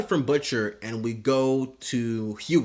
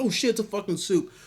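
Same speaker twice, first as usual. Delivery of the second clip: high panicked yelp; 10 semitones higher, faster than usual. Retold or imitated. Panicked reaction to wolf.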